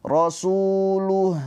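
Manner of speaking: Rasuluh, the final h breathed out long and flowing. Shown as a demonstration. Teacher demonstrates the flowing h to students. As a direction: let the h of Rasuluh keep streaming air. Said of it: "Rokhawah itu ada aliran suara".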